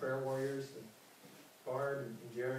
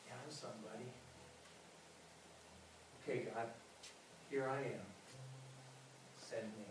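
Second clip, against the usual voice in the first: very soft, low surrender to God; hushed, humble answer; 4 semitones lower, 14 dB quieter than usual. Acted.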